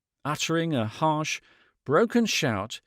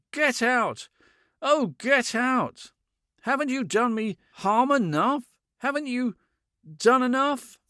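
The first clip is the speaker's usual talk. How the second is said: frantic anger; 10 semitones higher than usual. A narrator's reading. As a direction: drive her out in despair. Man driving her out.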